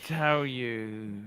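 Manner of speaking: usual speaking voice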